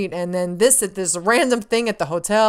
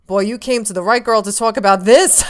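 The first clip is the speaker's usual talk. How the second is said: loud, punchy re-enacted enthusiasm; eager fan boast; 2 semitones higher, 9 dB louder than usual. Fan asked about Last of Us trailer, eager boast.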